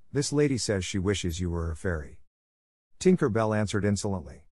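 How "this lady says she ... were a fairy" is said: plain reading voice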